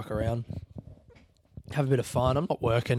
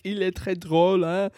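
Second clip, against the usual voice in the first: playful French; 7 semitones higher, 5 dB louder than usual. Retold or imitated. Teasing the shop guy in French.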